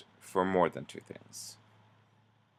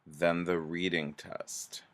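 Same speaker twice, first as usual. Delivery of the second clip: slow example sentence; much slower than usual. Shown as a demonstration. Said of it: "two sentence examples".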